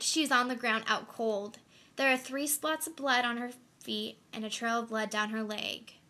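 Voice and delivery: ordinary talk, nothing marked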